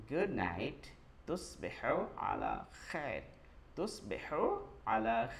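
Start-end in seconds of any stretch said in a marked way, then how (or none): none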